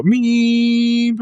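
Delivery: high thin electronic "Meep"; monitor beep imitation. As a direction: chirp it like a heart monitor alarm. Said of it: "freak out and go".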